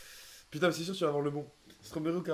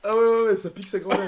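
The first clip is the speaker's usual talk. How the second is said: loud; 5 semitones higher, 11 dB louder than usual. Real, not acted. In pain from the spice.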